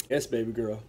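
calm her down gently; soothing. Retold or imitated. Comforting crying girl.

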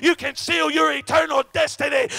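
loud, fervent, shouted sermon cadence; full-force preaching. Acted. Preacher calling the congregation to faith, shouting fervently. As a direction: hammer each line home to the congregation. Preacher urging listeners toward salvation.

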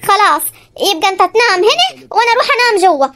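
ordinary talk, nothing marked

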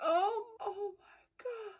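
high, loud shocked cry of disbelief; gasping out loud in real shock; shocked outcry. Real, not acted. Viewer watching anime, shocked by a death, crying out.

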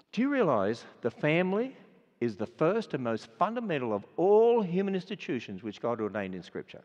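ordinary talk

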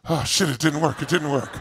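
deflated giving up. Retold or imitated. Imitating a fire-walker losing nerve, low deflated sigh. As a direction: let the air out of you. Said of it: "They'll go".

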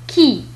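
"كي" with a plain k sound. Shown as a demonstration. Teacher modelling French Q for learners, k in "كي". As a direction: say "كي" like Arabic kaf plus i. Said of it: "وهو ينطق مثل الكاف".

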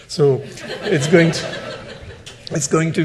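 laughing. Real, not acted. Joke punchline after Woody Allen.

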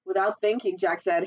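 ordinary delivery, nothing marked

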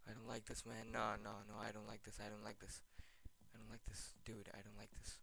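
soft, low, anxious repeating; frightened gamer murmuring at the creepy scene; scared murmur. Real, not acted. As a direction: plead quietly to yourself. Scared by rising heartbeat.

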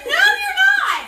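shrieking disbelief. Real, not acted. Shocked pregnancy reveal reaction.

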